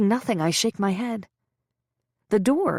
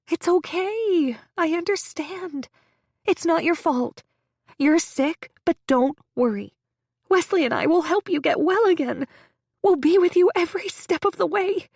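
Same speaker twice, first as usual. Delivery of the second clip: high sweet reassurance; 9 semitones higher, 3 dB louder than usual. A narrator's reading. Friend soothing the sick patient, high and sugary. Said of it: "she says".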